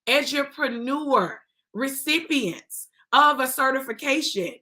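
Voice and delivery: usual speaking voice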